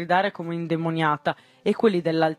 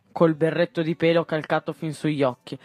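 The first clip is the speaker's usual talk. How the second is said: plain reading voice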